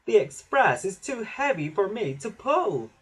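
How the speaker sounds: high, plaintive complaint; overburdened little engine protesting; Toby puffing. A narrator's reading. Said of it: "Toby puffed".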